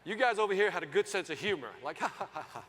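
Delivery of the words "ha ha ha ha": light imitated chuckling, ha ha ha; mock audience laugh. Comic mimicking the laughing side of the room, mock chuckle. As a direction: laugh like an easy crowd.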